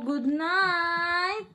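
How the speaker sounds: ordinary delivery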